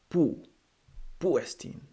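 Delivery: Pu, said clearly as poo; modelling the Greek Pu for learners; pronounced Greek word. Shown as a demonstration. Teacher modelling Greek pronunciation for students. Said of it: "Pu means where".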